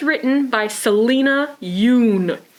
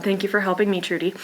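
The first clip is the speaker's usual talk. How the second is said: ordinary delivery, nothing marked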